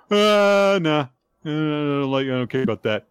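exaggerated whiny brush-off; mock disinterest. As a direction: act out a reader who can't be bothered. Voicing an uninterested reader.

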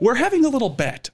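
high giggly voice; imitating her. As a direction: chirp it up high and playful. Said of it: "she was just like".

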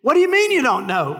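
high-pitched, baffled official; acted exasperated gatekeeper; incredulous angel. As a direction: demand an explanation in disbelief. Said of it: "The angel".

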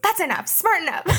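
high-pitched mock parental scolding; mom mode. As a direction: snap them back in line like a fed-up mother. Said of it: "judo in, like, mom mode".

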